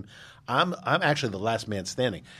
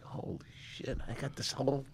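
whispered thought; 5 semitones higher, 8 dB quieter than usual. Retold or imitated. His thought on getting the wine.

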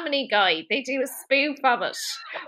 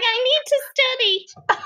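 high, hammed-up desperation; mock frantic insistence; 11 semitones higher than usual. [name] insists to Zach, mock frantic high voice. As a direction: imitate an overwrought student.

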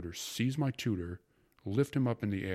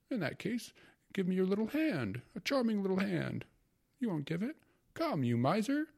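put-on higher, coaxing older gentleman; playful uncle; 13 semitones higher than usual. A narrator's reading. Uncle coaxing Tatiana to give her hand, higher and playful.

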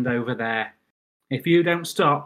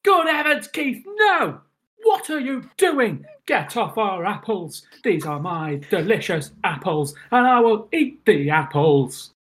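indignant; 7 semitones higher, 5 dB louder, slower than usual. Acted. Telling Keith to get off the apples, indignant. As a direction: play the affronted apple owner.

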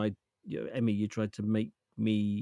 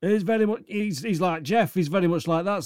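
loud, raised Yorkshire voice; higher, louder Yorkshire accent; 5 semitones higher, 4 dB louder than usual. Retold or imitated. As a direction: put on a punchy Yorkshire uncle voice. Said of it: "my uncle is more Yorkshire".

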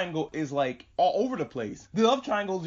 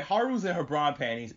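usual speaking voice

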